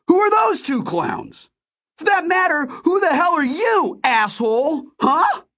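very high, angry shouted questions; shouting. A narrator's reading. Narrator demands to know who the men are, shouting. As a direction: demand answers at the top of your voice. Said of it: "I started shouting".

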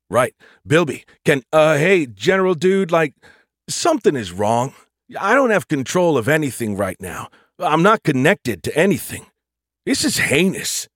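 rattled slacker dude reporting trouble; laid-back dude AI, alarmed. Acted. Bilby telling the General he's lost control, alarmed dude.

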